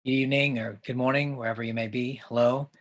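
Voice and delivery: normal talking voice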